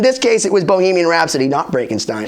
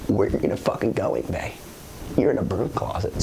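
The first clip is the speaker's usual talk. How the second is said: whispering; 14 semitones lower, 10 dB quieter, faster than usual. Retold or imitated. Inner thought mocking [name].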